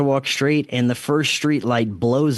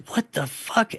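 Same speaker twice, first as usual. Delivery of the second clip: retold freak-out; 9 semitones higher, 3 dB quieter than usual. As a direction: retell a freaked-out reaction without raising the volume. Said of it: "I just freaking out".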